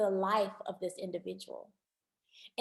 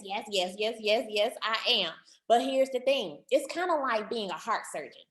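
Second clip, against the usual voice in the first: normal talking voice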